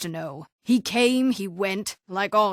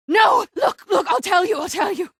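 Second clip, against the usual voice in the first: high, frantic shouting surrender; frantic, giving in fast; panicked plea; 10 semitones higher, 4 dB louder than usual. Acted. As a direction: blurt it out in terror. Panics as her tree is threatened.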